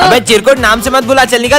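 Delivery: loud, scornful dismissal; angry brush-off. Acted. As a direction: send the kids packing loudly. Brushes off children claiming friendship. (गुस्से में ज़ोर से भगाना)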